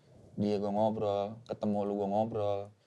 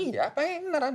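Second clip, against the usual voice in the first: much higher, playful parent reply; mock parent voice. Parent answering child's disbelief, high playful voice. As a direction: tease the kid with a sweet yes.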